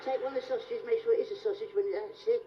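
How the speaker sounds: usual speaking voice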